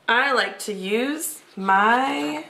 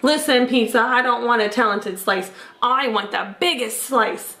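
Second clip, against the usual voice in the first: plain reading voice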